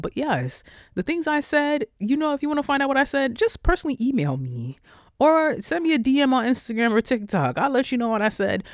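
ordinary talk, nothing marked

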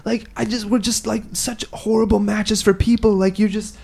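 high, whiny mock lovers' rant; hammed-up emotional outpouring; imitated distraught girlfriend. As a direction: play the overwrought ex, pitched high. Imitates distraught ex ranting at him.

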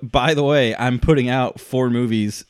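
high, loud mock announcement; loud acted boast. Host quoting the director's announcement, loud and emphatic. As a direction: proclaim it loudly as the boastful director. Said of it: "But he's like".

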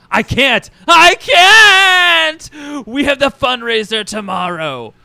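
very high, loud, stretched wailing; melodramatic wail. Acted. Boss refusing Emma's vacation idea, hammed-up despairing wail. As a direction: wail like a tantruming diva.

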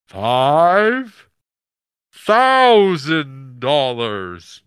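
very loud, very slow booming voice; booming god voice. Retold or imitated. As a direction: thunder the price like a god. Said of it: "he has a booming god voice".

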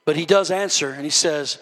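ordinary delivery

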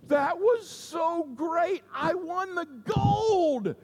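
high, slow, giddy joy through a laugh; overjoyed swimmer. Retold or imitated. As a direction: beam and laugh it out like you just won gold. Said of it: "and he says".

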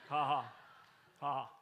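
deliberate staged ha ha; mock laugh. Preacher leads the crowd in a mock laugh at a lie. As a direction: fake a scornful laugh on cue. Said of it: "let's just laugh at that".

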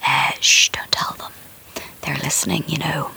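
hushed, conspiratorial whisper; mock secret whisper. Host hiding her Facebook hate from listeners, mock whisper. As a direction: whisper it like they're eavesdropping.

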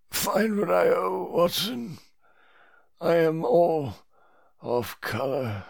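high, slow, fading weakly to nearly nothing; feeble high whine. A narrator's reading. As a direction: let it trail off, exhausted. Whitney weak, high, fading.

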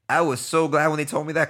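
normal talking voice

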